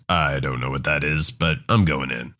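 low, stubborn American tourist voice; confused American tourist. Retold or imitated. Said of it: "the American looks confused and says".

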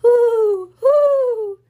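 high, loud mock owl hoo; high owl hooting; surprised owl hoot. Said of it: "called an owl with a mild surprise".

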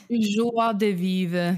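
slow, drawn-out French accent. Shown as a demonstration. Performing on her co-host's request, French accent. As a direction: stretch it out in a mock French accent. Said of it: "Say joie de vivre in a French accent".

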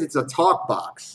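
normal talking voice

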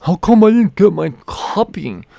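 imitating a complaining shelf shopper; whiny customer. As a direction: play the clueless late customer, griping.

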